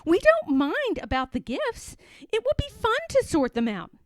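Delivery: put-on high, fast eager child voice; eager little boy. Boy promising the nurse to sort the gifts, high and quick. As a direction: rush to convince the grown-up.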